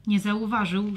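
ordinary delivery

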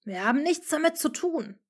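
high, loud, angry retort; angry hiss. Acted. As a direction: snap back in anger. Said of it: "zischte Harry nun wütend".

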